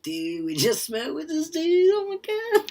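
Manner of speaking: very high mock-teen gushing, slowed down; giddy stoned teen. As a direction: imitate an awestruck kid after getting high. Mimics excited teens after smoking weed.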